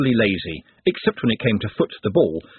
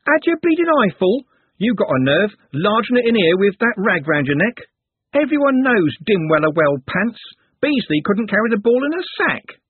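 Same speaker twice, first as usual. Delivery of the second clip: much higher, louder brash jeer; rough street girl; 10 semitones higher, 4 dB louder than usual. Acted. Juliet jeers at Trev over his football scarf. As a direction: sneer at him loud and brash. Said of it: "in a voice like pearls".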